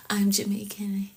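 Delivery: soft whispered aside. Real, not acted. Presenter confiding to viewers, playful whisper. Joking aside about her accent.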